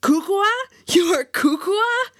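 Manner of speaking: high, loud incredulous echo; amazed delight. A narrator's reading. Suitor astonished at the foreigner's local name, amazed delight. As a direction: repeat the name in rising, delighted disbelief. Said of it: "His explosive laughter made me cringe".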